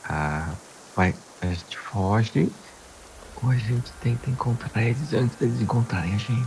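soft, low and hesitant; speaking low. Acted. Player answers Pérsia in character about fleeing or finding them, hushed. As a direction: answer under your breath, feeling for a plan. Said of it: "Vou falar. É, é que a Pérsia sabe falar baixo".

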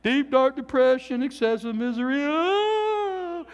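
hammed-up wailing woe-is-me; mock despair. Pastor mocking worldly complainers, hammed-up misery. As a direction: overplay the gloom like a sulking complainer. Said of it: "we dare not be like the world".

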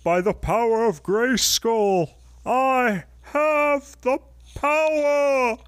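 high, slow heroic battle cry; He-Man shout. Acted. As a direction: proclaim like a cartoon hero. He-Man transformation quote.

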